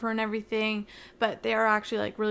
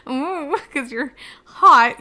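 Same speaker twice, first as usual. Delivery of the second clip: louder, slow teasing coo; flirty coo; 3 semitones higher, 8 dB louder, slower than usual. Retold or imitated. Heroine teasing the man she fights, mock flirty coo. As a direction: purr it, half joking.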